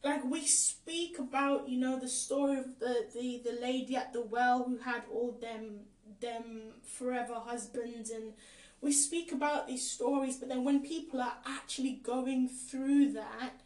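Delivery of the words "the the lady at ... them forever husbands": ordinary talk, nothing marked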